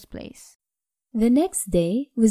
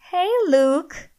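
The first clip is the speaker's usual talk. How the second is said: giggling; 9 semitones higher, 4 dB louder than usual. A narrator's reading. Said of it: "she giggled".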